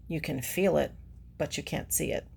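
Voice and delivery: ordinary talk, nothing marked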